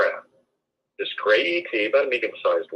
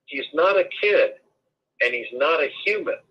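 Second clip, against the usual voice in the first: half-voiced yell, pitched up; retold yell; 7 semitones higher than usual.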